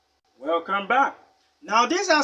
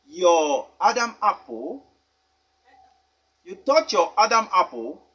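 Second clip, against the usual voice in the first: ordinary talk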